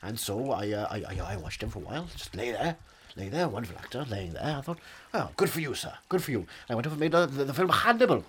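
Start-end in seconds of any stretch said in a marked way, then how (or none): none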